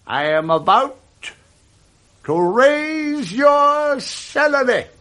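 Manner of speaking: high, slow, delighted surprise; spring the good news slowly; joyful reveal. Acted.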